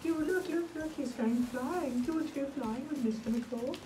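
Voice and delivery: sing-song playing with a little one. Real, not acted. Adult playing with child and kite.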